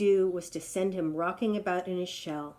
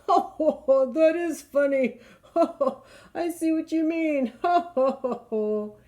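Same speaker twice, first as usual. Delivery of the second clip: high, louder ho-ho laughing; roaring laughter; 7 semitones higher, 6 dB louder than usual. Acted. Lion laughing at the tangled giraffe, roaring. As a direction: laugh through every word like a jolly lion. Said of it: "a great roaring laugh".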